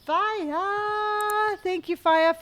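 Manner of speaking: high, bright shout of a name; excited call-out. Real, not acted. Greeting a chat viewer excitedly.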